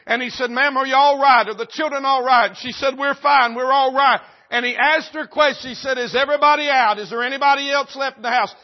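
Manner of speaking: high, loud, urgent storytelling; preaching at full force. A narrator's reading. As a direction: drive the fire story home at full volume.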